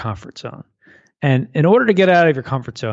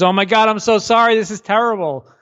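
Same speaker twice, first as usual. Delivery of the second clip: alarmed neighbor; 6 semitones higher than usual. Acted. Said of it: "He comes running over and goes".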